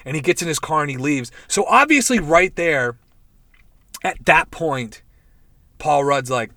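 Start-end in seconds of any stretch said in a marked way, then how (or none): none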